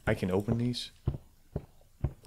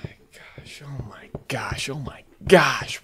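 slow, breath-only whisper after a shock; shaken whisper; slower than usual. Real, not acted. Player recovering from a scare, shaken whisper. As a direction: whisper it, slowly gathering voice.

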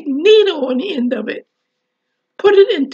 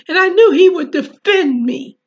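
ordinary delivery

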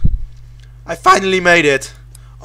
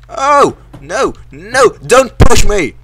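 high, loud cries of protest; alarmed yelling; 4 semitones higher, 9 dB louder, slower than usual. Real, not acted. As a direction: yell out in sudden alarm. Pushed toward danger.

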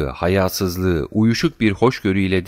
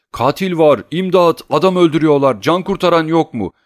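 high, loud alarmed cries; shouting for help; 9 semitones higher, 6 dB louder than usual. A narrator's reading. Tarantiyev yells for rescue from Oblomov, high and loud. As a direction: cry murder so the whole street hears. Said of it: "Tarantiyev bağırıyordu".

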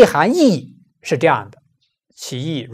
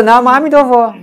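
higher, louder parroting chant with a laugh; imitating a parrot; 6 semitones higher, 4 dB louder than usual. Preacher mimicking a parrot reciting the Buddha's name, laughing parrot voice.

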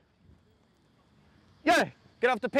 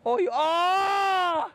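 alarm shout; 8 semitones higher, much slower than usual. Real, not acted. Coach reacting to a near miss.

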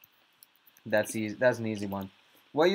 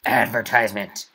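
mock announcer; 7 dB louder than usual. Repeated ad announcement.

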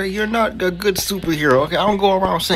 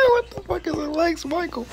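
laughing disbelief; 13 semitones higher, 4 dB quieter than usual. Real, not acted. Laughing at a silly name.

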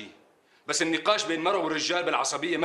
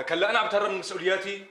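indignant retort; 6 semitones higher than usual. Acted. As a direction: throw the accusation back at her. Husband protests in quarrel.

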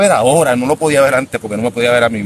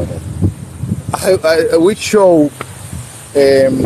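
slow, searching English; halting slow English; much slower than usual. Real, not acted. Host searches for English words, very slow halting. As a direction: drag the words while hunting for them. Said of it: "para los que no sepan mucho español".

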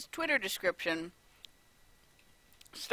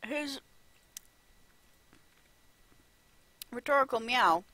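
talking while chewing; mouth full; 4 semitones higher than usual. Real, not acted. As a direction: say it around a bite. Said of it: "nom, nom".

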